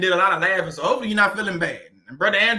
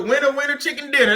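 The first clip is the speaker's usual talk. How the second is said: excited catchphrase; 8 semitones higher, 6 dB louder than usual. Real, not acted. Cheering a viewer's winning idea.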